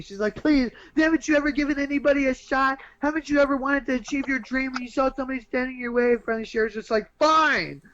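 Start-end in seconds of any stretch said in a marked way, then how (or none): 0.4-6.1 high, urgent hammed-up plea; mock begging. Dancer begging the club owner for an audition, mock pleading. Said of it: "starts begging and begging". 7.2-7.8 loud, high mock-exasperated outburst; exasperated giving-in. Club owner giving in to the begging dancer, mock exasperated.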